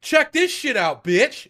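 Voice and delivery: high loud brash mock boast; cocky taunt. Retelling heroine stripping in the street, brash high taunt. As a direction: squeal it like showing off. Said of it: "she's like".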